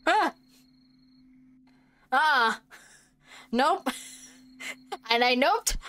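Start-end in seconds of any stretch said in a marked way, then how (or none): 0.1-2.6 startled scream. Real, not acted. Jump scare reaction.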